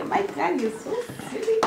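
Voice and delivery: put-on high, fast, soft baby voice.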